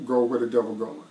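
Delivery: usual speaking voice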